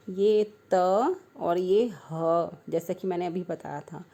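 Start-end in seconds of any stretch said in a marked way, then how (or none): 0.1-2.5 slow, deliberate letter by letter; slow spelling out. Shown as a demonstration. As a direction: spell it out slowly for young pupils. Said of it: "teacher spells out letters".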